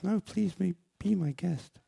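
a hint of a polite young woman. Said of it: "She goes".

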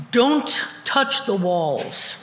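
slow, spaced-out, weighty words; each word set apart slowly; deliberate sign reading. Acted. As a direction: read it out like big block letters. Reads sign in big block letters.

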